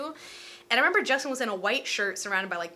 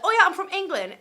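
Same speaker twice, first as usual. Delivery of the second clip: high, loud put-on English accent; hammed-up English accent, loud; 9 semitones higher, 7 dB louder than usual. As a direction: imitate an English fan, pitched up. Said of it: "that was an English accent".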